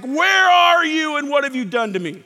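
loud, high cry to God; acted anguished complaint. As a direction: cry out as David demanding an answer. Acts out David's desperate complaint.

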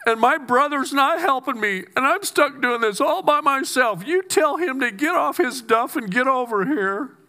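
high, whiny complaining voice; Martha's complaint. Retold or imitated. Martha whining to Jesus about her brother, high and whiny. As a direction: play a fed-up Martha griping to Jesus. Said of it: "if I was in Martha's place".

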